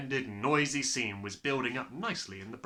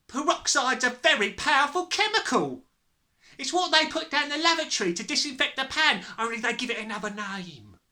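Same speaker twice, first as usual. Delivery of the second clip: high, raised voice, easing at the end; loud lecturing; 13 semitones higher, 7 dB louder than usual. A narrator's reading. Mother warns about peroxide, lecturing loudly.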